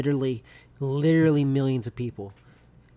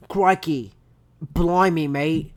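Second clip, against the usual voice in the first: Australian accent; 2 semitones higher, 5 dB louder, much slower than usual. Shown as a demonstration. Warming up to quote someone in an Australian accent. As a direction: punch out Aussie catchwords one by one. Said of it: "Do that Australian accent real quick".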